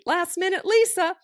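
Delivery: high, loud, drawn-out singsong; playfully cute self-nickname; trying to sound cute. Retold or imitated. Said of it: "I try and make it sound cute".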